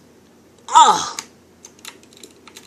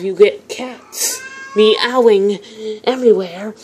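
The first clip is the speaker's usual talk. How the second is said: ordinary talk, nothing marked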